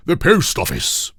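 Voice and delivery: loud, high exasperated king voice; king impatient with the trolley, loud. A narrator's reading. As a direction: explain it impatiently as if it were obvious. King voice in story.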